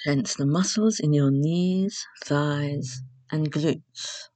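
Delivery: measured relaxation instructions with long pauses; guided meditation. Acted.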